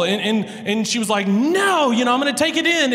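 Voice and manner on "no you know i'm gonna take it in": put-on high, fast, whiny child's protest; tearful little girl. Daughter near tears refusing dad at school drop-off, high tearful whine. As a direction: pitch up and whine it like his teary daughter.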